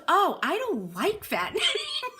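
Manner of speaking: put-on high, slow kid voice breaking into a laugh; blunt little girl. Little Emily telling the host she dislikes her name, high and giggly. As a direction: play the honest five-year-old, laughing through it. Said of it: "She goes".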